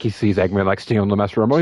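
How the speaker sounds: normal talking voice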